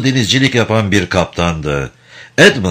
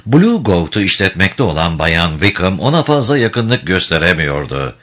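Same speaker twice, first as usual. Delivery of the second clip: normal talking voice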